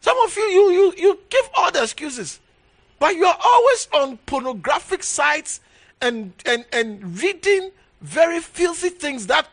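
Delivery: high, loud scolding rebuke; high loud scolding preacher; rebuking shout. Acted.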